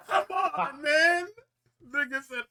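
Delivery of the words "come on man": excited outburst. Real, not acted. Excited outburst after laugh.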